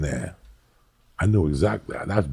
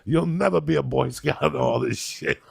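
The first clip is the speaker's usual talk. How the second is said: mock scolding; 9 semitones higher than usual.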